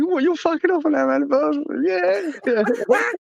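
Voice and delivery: very high put-on wife voice, indignant; shrill hammed-up wife accusation; mock outraged wife. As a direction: squeak it out like a wife who's caught him. Imagined wife catching him out.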